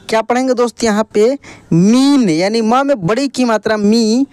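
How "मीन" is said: मीन with a long ī arching up and down; drawn-out long ī. Shown as a demonstration. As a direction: stretch out the ī in मीन. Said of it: "इसका उच्चारण खींच कर होता है". (मीन की ई खींच कर)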